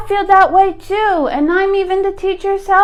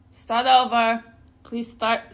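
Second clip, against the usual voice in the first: ordinary talk, nothing marked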